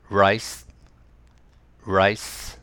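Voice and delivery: rice said twice with a curled R and rising end; R quiz word. Shown as a demonstration. Teacher quizzing students on L or R. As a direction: give the R sound for guessing. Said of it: "Rだったのか当ててください".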